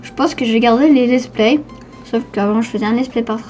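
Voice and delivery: ordinary delivery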